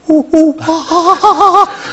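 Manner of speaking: very high, loud jungle hooting; chimp call. Retold or imitated. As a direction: hoot like a chimp in the trees. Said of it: "Cheetah, that's Cheetah".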